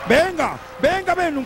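rising, drawn-out cheer; egging the wrestler on; excited urging. Real, not acted. Commentator urges wrestler on. (ánimo emocionado)

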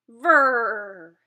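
loud buzzing engine noise; boat engine vroom. Shown as a demonstration. Reading to kids, imitating a loud boat engine. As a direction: rev it like a motorboat.

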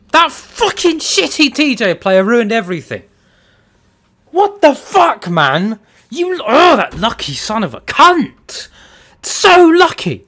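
loud, high furious yelling; furious rant. Real, not acted. Gamer raging at a lucky opponent, furious rant. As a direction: rage at full voice, real anger.

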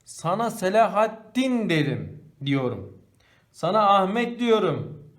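slow, loud, pressed names Selahattin and Ahmet; emphatic stress. Shown as a demonstration. Teacher showing emphasis, slow stressed names. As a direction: lean hard on each name. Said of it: "Vurgularda kullanılır".

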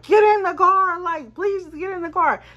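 high, loud get in the car, then pleading; desperate yell. Retold or imitated. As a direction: shout at him to get in. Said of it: "I'm like".

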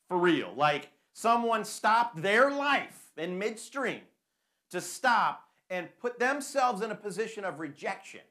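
loud, slow, drawn-out preaching; preaching emphasis. Real, not acted. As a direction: lean hard into each word.

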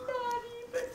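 very high, squeaky lament; lamenting Toad. Acted. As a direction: wail it in a tiny high character voice.

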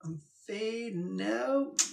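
high, slow, stretched-out words rising softly; playful high, soft, elongated delivery; mock fading away. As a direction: draw it out high as if drifting away.

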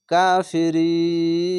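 কাফিরিন recited slowly with the ee held long; pausal recitation. Shown as a demonstration. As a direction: stretch কাফিরিন over three counts before the stop. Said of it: "আর যদি এখানে থামেন".

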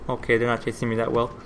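ordinary talk, nothing marked

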